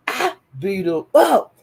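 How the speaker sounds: usual speaking voice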